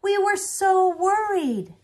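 slow, high, loud relief; relieved cry. Acted. Grandfather hugging the twins, loud relief. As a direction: pour out the worry.

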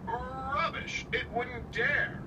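scoffing. Acted. James scoffs at the warning.

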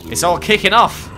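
real alarmed excitement; excited outburst. Real, not acted. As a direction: blurt it out as things erupt. Sudden mob chaos in game.

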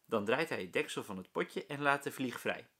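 ordinary delivery

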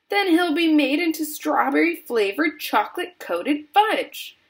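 high wail peaking on the last word; frantic high-pitched outcry; screamed horror. A narrator's reading. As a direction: scream in horror at your son's fate. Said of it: "screamed Missus Gloop".

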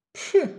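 sharp high loud pff; dismissive snort. Acted. Boy snorts at his doubting friends, scornful pff. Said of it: "prychnął Gottfried".

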